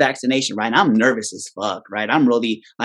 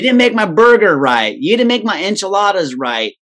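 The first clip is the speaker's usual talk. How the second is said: raised, indignant customer complaint, faster at the end; mock angry customer; 7 semitones higher, 4 dB louder than usual. Diner scolding the restaurant staff, mock indignant. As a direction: huff like an entitled diner.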